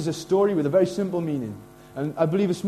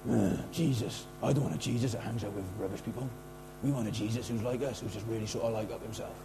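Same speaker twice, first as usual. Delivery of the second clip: low, hushed mock grumble; grumbling; 7 semitones lower, 12 dB quieter than usual. Religious people complaining about Jesus's company, grumbling. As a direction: grumble under your breath, low and disdainful. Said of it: "And they're all grumbling".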